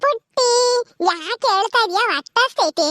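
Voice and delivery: high, drawn-out put-on voice; childlike young aunt. Young aunt consoles the crying baby, high childlike cooing. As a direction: coo slowly to a crying baby.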